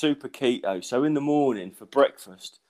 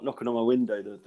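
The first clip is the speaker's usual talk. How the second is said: ordinary delivery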